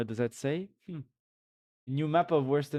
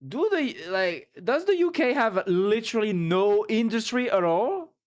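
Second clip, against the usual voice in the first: incredulous rant; 7 semitones higher, 4 dB louder than usual. Real, not acted.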